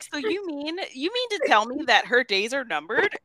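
high-pitched, hammed-up question with a grin; smiling bad acting. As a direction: mimic an actor grinning through grave news. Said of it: "smiling the entire time".

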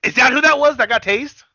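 high, loud burst of surprise; surprised realisation. Real, not acted. Learning from co-host who got tased, loud surprised realisation. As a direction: exclaim it as the penny drops.

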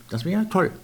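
plain reading voice

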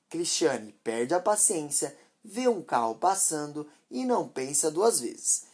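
plain reading voice

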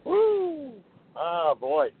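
usual speaking voice

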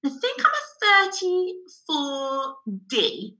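high, hesitant guess at an answer; imitated uncertainty, lifted in pitch; unsure customer. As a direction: voice a lady who isn't quite sure. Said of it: "And she's like".

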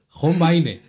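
"John Wayne" drawn out as Jon Báine; grandfather's hispanicised pronunciation. Retold or imitated. Repeating grandfather's version for laughs, Spanish-read John Wayne. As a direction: slowly say "John Wayne" by Spanish spelling rules. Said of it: "mi abuelo decía John Wayne".

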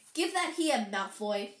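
plain reading voice

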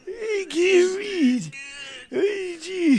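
put-on very high, drawn-out old man; hammed-up elderly voice. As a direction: wail it as a comic old-timer. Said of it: "I very much like my old man voice".

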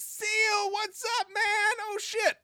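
high, excited mock greeting; loud and obnoxious. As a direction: call out to him like an old buddy. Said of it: "me being loud and obnoxious".

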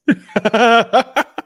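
loud, high burst of laughter; real laugh. Real, not acted. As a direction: crack up at a bad pun. Reacts to the pun.

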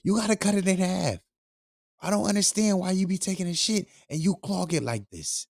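grandma scolding about the clogged toilet, high voice. Retold or imitated. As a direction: mimic a nagging grandma. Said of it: "She's like".

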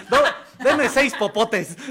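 slow, loud, laughing order; milk each word like a smug customer; mock demand. Joking friend mocking a straw lover, slow and loud.

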